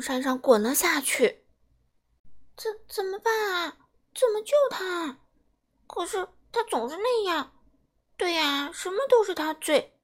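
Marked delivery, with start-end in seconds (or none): 2.6-10.0 worried. Acted. Babies panic over fallen brother.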